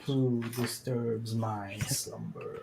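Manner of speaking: slow, hushed ancient guardian voice; talking statue. Pretend. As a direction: rumble it like a stone idol waking. Booming voice of woken guardian.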